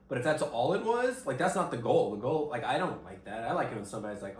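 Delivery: ordinary delivery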